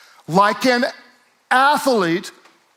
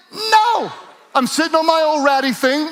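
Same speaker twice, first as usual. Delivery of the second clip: shouted refusal; 6 semitones higher than usual. Retold or imitated. Preacher shouting no at a no-money-down sofa offer. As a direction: yell it, proud of the old couch.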